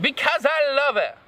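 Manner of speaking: mild, high-pitched mock shriek. A narrator's reading. Draco declares love to the professors, shrieking. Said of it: "And then Draco shrieked".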